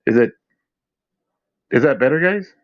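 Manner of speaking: ordinary talk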